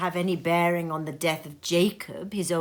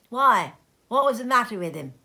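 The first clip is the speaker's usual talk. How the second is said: louder, quick startled asking; surprised question; 4 semitones higher, 6 dB louder than usual. Acted. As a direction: fire it off in surprise. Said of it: "asked a third".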